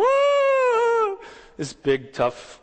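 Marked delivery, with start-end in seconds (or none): 0.0-1.2 very high mock girlish shriek; yelling like a girl. Preacher mocking tough commando's shriek at the snake, very high hammed-up yell. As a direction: squeal the "ah" like a panicked little girl. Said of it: "yelling like a girl".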